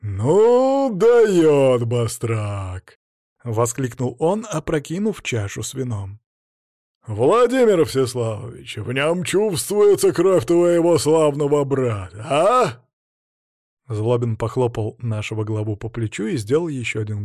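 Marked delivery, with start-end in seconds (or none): 0.0-3.0 put-on booming drunken laugh; loud laughing exclamation. A narrator's reading. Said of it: "громко и протяжно захохотал". 7.1-12.8 loud, slow tipsy boast; booming feast voice; hearty drunk boyar. A narrator's reading. Drunk boyar teases host.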